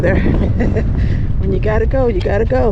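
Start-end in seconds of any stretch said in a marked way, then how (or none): 0.2-1.3 short chuckle; amused laugh. Real, not acted. Hiker spotting someone peeing, amused laugh. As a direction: chuckle at what you spotted.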